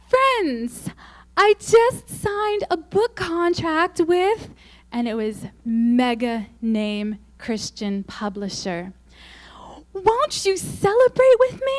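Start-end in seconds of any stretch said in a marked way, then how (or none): 0.1-4.5 high, sugary mock announcement, slow on the contract; gushing blogger. To her followers, a sugary gushing announcement. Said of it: "it said". 9.9-11.8 very high, loud sugary plea; mock her sweet invitation; gushing blogger. Begging followers to celebrate, high sugary mock plea. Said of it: "it said".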